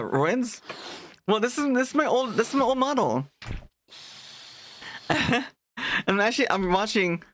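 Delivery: ordinary delivery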